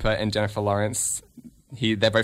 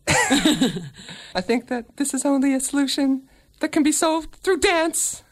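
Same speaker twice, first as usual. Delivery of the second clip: man doing a woman's voice; 13 semitones higher than usual. Acted. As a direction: coax him high and sweet. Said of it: "Where's your lady voice?".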